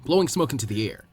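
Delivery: ordinary delivery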